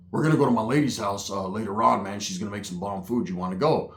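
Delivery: lightly voiced low laid-back tweaker; mumbled low invite from a spun-out uncle; Roach's voice. Roach inviting him over.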